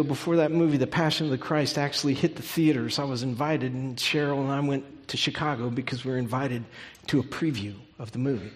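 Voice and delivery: usual speaking voice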